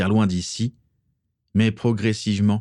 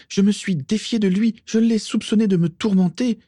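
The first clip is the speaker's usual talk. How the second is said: high-pitched, overwrought confession; desperate, fervent self-justification; agitated exclamation; 11 semitones higher than usual. A narrator's reading. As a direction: pour it out feverishly, voice way up high. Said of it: "s'exclama Bellatrix".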